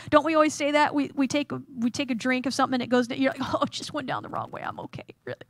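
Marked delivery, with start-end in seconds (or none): 3.5-5.4 quick, fading strained voice; mock choking excuse, petering out to nearly nothing; choked, playing it off. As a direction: pretend you're fine while losing air. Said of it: "trying to play it off like you're okay".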